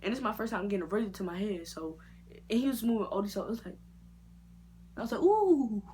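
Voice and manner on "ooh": high, loud startled ooh. Retold or imitated. Retelling the barber's rough clippers, a startled ooh. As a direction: yelp as the clippers bite.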